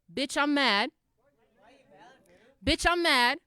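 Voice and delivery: punchy projected chant line; rallying call. Acted. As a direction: throw it out for the crowd to answer. Calls the line to the audience.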